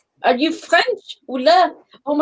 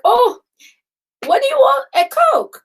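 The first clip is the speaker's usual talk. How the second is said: pitched up high, mock-puzzled asking; teasing bartender; 13 semitones higher, 5 dB louder than usual. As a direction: play the amused bartender, high and loud. Bartenders mocking her order.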